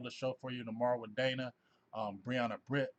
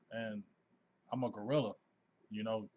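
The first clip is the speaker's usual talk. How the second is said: ordinary talk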